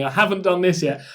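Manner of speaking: ordinary talk, nothing marked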